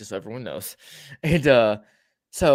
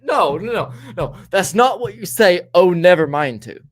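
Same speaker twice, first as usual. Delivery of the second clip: loud, higher mock protest; indignant disbelief; 4 semitones higher, 7 dB louder than usual. Storyteller protesting her walking off, indignant. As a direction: object loudly, as if scandalised by the brush-off.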